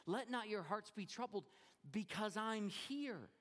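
reassuring Jesus. Retold or imitated. Jesus voiced reassuringly, slowing.

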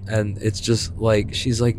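ordinary delivery

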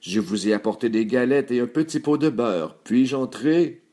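lightly put-on voice of a disguised wolf; a hint of a disguised wolf; wolf posing as granddaughter. A narrator's reading. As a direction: coax lightly to be let in. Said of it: "répondit le loup".

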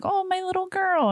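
very high cooing mother voice; doting mother. Retold or imitated. Imitating her mother fussing over her only daughter, high coo. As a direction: coo it up high like a fussy mom. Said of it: "And she's like".

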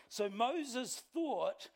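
drawn out, very slow storyteller read; slow dramatic reading. Shown as a demonstration. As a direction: stretch each word like a dramatic reveal. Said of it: "I'm doing that".

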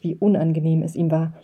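ordinary delivery

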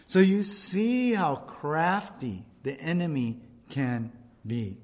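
normal talking voice

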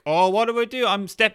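high, hammed-up helpless fretting; mock panic. Acting someone who stepped on a mine, mock panic.

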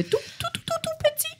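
squeaky little voice for tiny things; tiny high voice. Shown as a demonstration. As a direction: pitch way up to sound tiny. Describing tiny characters.

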